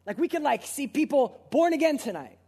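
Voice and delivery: excited preacher's cry; fervent hope. Real, not acted. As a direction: lift it up as a passionate appeal.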